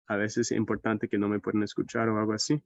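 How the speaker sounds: normal talking voice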